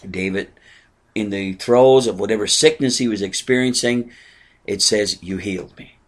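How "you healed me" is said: soft, lower take of You healed me; quiet quoted line. Acted. Preacher quoting David to the congregation, softly awed. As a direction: murmur the words as if in awe.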